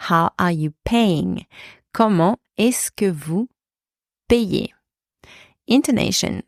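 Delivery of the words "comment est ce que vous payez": measured, pausing before the last word. Shown as a demonstration. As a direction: spell out the phrase by chunks. Teacher breaks down model phrase.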